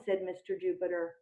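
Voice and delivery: plain reading voice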